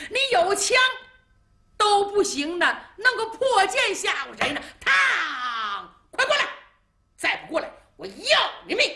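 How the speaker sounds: high, loud mocking taunt; a sneering general pushing for surrender; mocking threat. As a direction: jeer at him, voice pitched high and loud. Liu laughs angrily at Chai.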